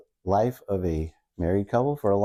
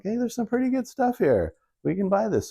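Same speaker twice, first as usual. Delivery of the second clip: much higher, chirpy, pleased with himself; mock excited self; 11 semitones higher than usual. Husband defending the markdown rack to his wife, high and chirpy. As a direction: sell the bargain like a kid with a find. His own cheerful retort.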